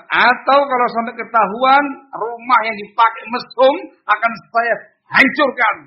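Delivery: high, loud official warning; acted authoritative angry ultimatum; threatening. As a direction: threaten them as a stern ruler. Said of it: "Itu kan ancaman".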